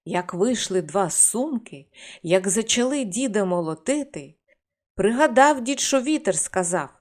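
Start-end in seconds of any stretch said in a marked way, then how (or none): none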